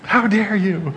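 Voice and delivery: playful fake indignation; mock angry. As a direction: ham up being offended. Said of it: "Wouldn't it be funny if I just got angry".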